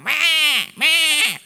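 loud, high imitation of a goat bleating; hammed-up loud goat cry. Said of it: "that stupid goat would just kept going".

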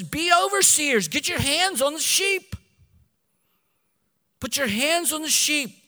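rally the elders to action; commanding preacher's call; preaching at full force. Acted. Preacher quoting Paul forcefully.

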